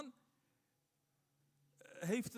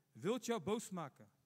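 ordinary talk

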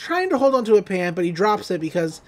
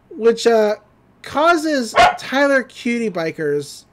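ordinary delivery

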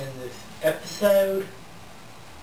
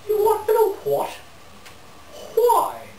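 appalled disbelief. Acted. Man hearing an outrageous phone request, appalled high squawk. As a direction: squeal it, aghast at the request.

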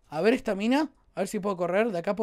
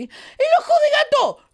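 excited shout; 13 semitones higher, 5 dB louder, slower than usual. Real, not acted. Ve el ojo de gato en el juego.

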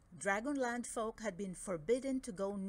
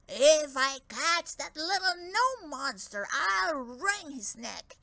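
loud, slow angry threat; growling giant; 5 semitones higher, 9 dB louder than usual. Acted. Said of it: "growled the giant".

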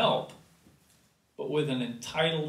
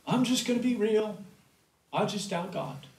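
flippant doubt; 5 semitones higher than usual. Retold or imitated. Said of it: "so much talk like that".

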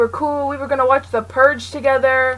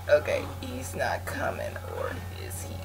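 hushed unvoiced whisper; 13 dB quieter than usual. Real, not acted. Hiding from the killer, tense whisper. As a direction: whisper so he won't hear.

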